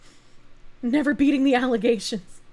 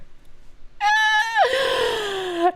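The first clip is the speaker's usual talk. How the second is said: shrieking laugh; 13 semitones higher than usual. Real, not acted. Big laugh at chat joke.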